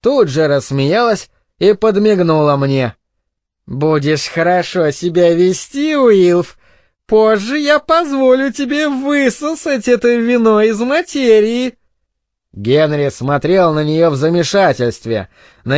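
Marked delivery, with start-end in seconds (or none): 3.7-11.8 rising into a high, teasing woman's voice; tipsy teasing mother. Acted. Drunk mother winks at her husband Уилф, teasing. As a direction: tease him, climbing high in pitch.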